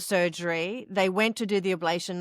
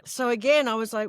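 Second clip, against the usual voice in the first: usual speaking voice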